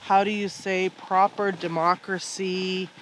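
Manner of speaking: usual speaking voice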